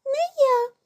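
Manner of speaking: high-pitched squeaky mouse; calling little mouse. A narrator's reading. Mouse Krut refuses to carry grain, squeaky call. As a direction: pipe out a short refusal. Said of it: "гукає круть".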